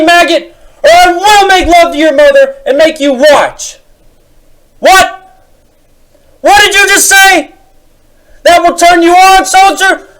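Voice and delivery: very high, loud barking shout; drill sergeant. Acted. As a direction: bark orders like a drill sergeant. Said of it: "my drill sergeant routine".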